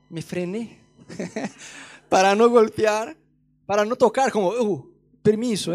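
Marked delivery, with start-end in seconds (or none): 4.5-5.7 loud, slow "permiso" to the demons; mock polite shove. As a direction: push past them, drawn out and loud. Telling demons to step aside.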